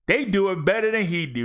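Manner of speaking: ordinary talk, nothing marked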